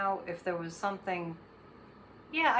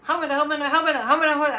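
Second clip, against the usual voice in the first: higher, louder burst of mock stammering; flustered babbling; 7 semitones higher, 11 dB louder, much faster than usual. Imitating Sean Hannity trying to just keep talking, flustered babble. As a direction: babble loudly like someone scrambling for words.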